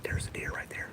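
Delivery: hushed whisper so the deer won't bolt; real hushed whisper on the trail. Real, not acted. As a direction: whisper it, barely voiced. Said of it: "That's why I'm talking low".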